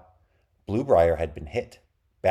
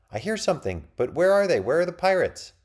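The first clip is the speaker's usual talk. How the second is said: put-on high, eager kid's voice; bursting with impatience; excited child; 12 semitones higher, 4 dB louder than usual. A narrator's reading. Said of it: "That was Breen asking".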